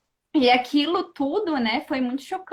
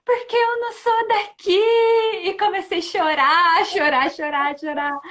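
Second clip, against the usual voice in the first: high, sung-out wail through tears; tearful confession; 5 semitones higher, 4 dB louder than usual. Retold or imitated. To the healer, a high tearful wail. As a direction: cry it out like a lost soul. Crying confession in healing session.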